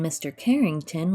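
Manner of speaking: ordinary delivery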